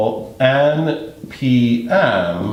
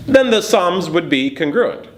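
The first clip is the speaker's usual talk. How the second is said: then lifted high and bright with discovery; excited discovery; 7 semitones higher than usual. Shown as a demonstration. Teacher realizing the sums match, excited. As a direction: sound like you just found the answer. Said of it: "If the same segment is added".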